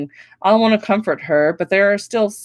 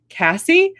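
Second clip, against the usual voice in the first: much higher, surprised call of a name; 12 semitones higher than usual. A narrator's reading. Girl spotting her best friend at the elevator, surprised.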